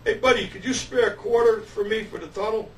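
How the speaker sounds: pleading holler to a passerby; retold yell. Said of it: "I yell out the window".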